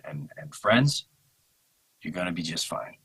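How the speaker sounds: normal talking voice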